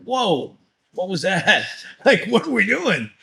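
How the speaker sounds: high, laughing mock shock; startled disbelief. Coach reacting to overbearing sports parents, in laughing disbelief. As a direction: react like you just saw something outrageous. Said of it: "and I go like".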